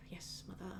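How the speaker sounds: barely audible, breathed compliance; resigned whisper. Acted. Son giving in to his mother, resigned whisper. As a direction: give in under your breath.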